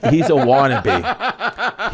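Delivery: slow, drawn-out, laughing; mock slow emphasis. Comic quoting the restaurant owner slowly, half laughing. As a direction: drag each word out. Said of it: "He goes".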